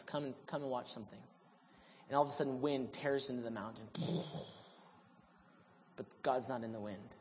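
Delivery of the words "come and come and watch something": quiet call. Retold or imitated. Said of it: "the Lord calls him out and says".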